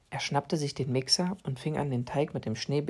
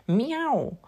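a higher mock meow with a falling end; high cat meow; 8 semitones higher, 3 dB louder than usual. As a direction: meow up high, then drop off. Cat meows at the clock.

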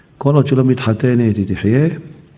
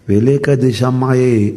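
slow quote; much slower than usual. Acted. Slow Aramaic quote read out.

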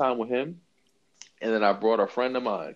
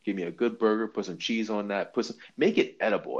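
usual speaking voice

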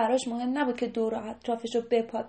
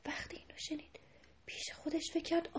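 hushed storytelling whisper; 3 semitones higher, 13 dB quieter than usual. A narrator's reading. Storyteller whispering the deer's thought moment. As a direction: whisper it to the children.

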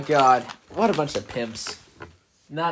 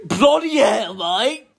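raised, exaggerated British cursing; mock British accent; 6 semitones higher, 6 dB louder, much slower than usual. Friend mocking British strangers in the chat, loud mock British. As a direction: exclaim it like a cartoon Brit.

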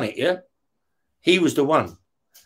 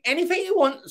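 much higher, soft camp voice; camp offer; 11 semitones higher, 4 dB quieter than usual. Retold or imitated. Terry offering Ray anything he wants, high camp voice. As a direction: offer help in a sugary camp tone.

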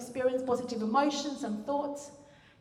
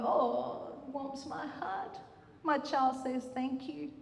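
touched parent; 2 semitones higher, 5 dB quieter, slower than usual. Retold or imitated. Parent touched by child's thanks.

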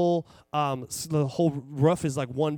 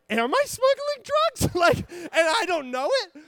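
panicked realization; 11 semitones higher, 3 dB louder than usual. Retold or imitated. Realizing he might be smuggling drugs, high panicked voice. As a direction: wonder aloud in rising panic. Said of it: "And I start to panic".

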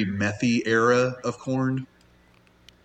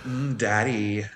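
sultry voice; 3 dB quieter, slower than usual. Retold or imitated. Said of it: "a porno thing now".

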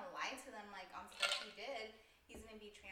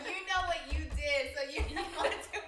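loud, laughing tease; 6 semitones higher, 14 dB louder than usual. Pretend. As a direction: laugh through the joke. Laughing joke about parents.

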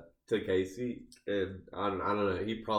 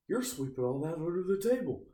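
higher-pitched mock yell, rising through the line; imitated yell; 8 semitones higher than usual. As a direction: retell his scolding yell in a raised pitch. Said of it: "and he yells".

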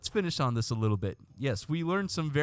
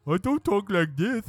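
higher, put-on parody of the way he supposedly talks; mock version of his own voice; 8 semitones higher than usual. Shown as a demonstration. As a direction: exaggerate what others think you sound like. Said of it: "seems to think I talk like this".

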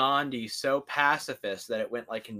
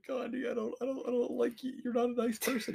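much higher, soft whimper; mock whimpering; 11 semitones higher, 5 dB quieter than usual. Player complaining to Gandhi, whimpering. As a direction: whimper like a hurt child.

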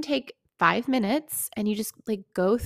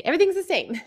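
mock client insisting; 8 semitones higher, 4 dB louder, faster than usual. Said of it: "That's always what they say".

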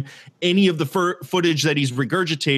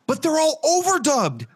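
incredulous laughing rant; 14 semitones higher than usual. Real, not acted. Co-host griping about awful dubbing, high incredulous laughing exclamation. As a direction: voice shooting up high through laughter.

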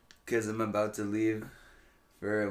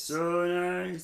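mock echo; 8 semitones higher than usual. Echoing partner's praise.

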